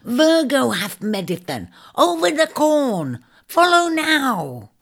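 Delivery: very high, slow bird cry; urgent shrike hiss. Acted. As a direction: command him high, each word stretched out. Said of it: "it hissed urgently".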